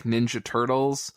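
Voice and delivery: ordinary talk, nothing marked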